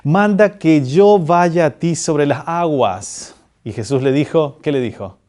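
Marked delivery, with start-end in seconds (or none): 0.0-3.4 súplica actuada, lenta y fuerte; Pedro. Retold or imitated. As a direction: proclama el pedido alargando las palabras. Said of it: "Pedro responde y le dice". (voz alta y solemne, muy pausada)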